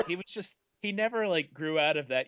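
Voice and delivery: ordinary talk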